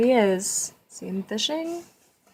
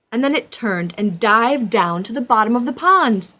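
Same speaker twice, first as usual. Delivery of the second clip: dramatic narration; 11 dB louder than usual. A narrator's reading. Dramatic storybook reading.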